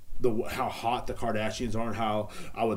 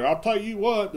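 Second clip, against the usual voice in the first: high, louder mock country twang; country guy impression; 10 semitones higher, 5 dB louder than usual. Mocking the country judge on The Voice, high and twangy. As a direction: ham up the country judge's catchphrase. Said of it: "the country guy was like".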